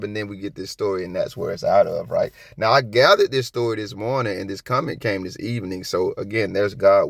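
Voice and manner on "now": ordinary talk, nothing marked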